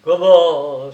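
loud exclamation of shock; explosive, astonished question; startled cry. A narrator's reading. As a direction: cry out in disbelief. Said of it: "s'écria-t-il".

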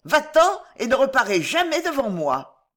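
high-pitched outraged banishment; high, vehement outcry; crying out angrily. A narrator's reading. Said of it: "s'écria Maria".

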